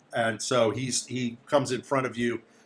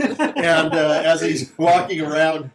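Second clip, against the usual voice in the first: louder, laughing through the telling; amused laughing narration; 4 semitones higher, 7 dB louder, slower than usual. Real, not acted. Game master telling players of the slip, laughing. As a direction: narrate it while cracking up. Narrator cracks up at the guide's fall.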